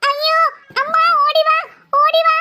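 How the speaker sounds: high, scared call to mother; panicked child calling out; frightened cry for help. Acted. Child calls mother in terror.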